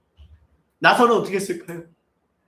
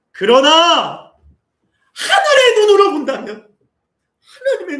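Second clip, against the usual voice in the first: much higher, louder, voice breaking with tears; tearful cry; 12 semitones higher, 12 dB louder, slower than usual. Real, not acted. Preacher to the congregation, tearful high cry. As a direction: cry it out slowly toward heaven.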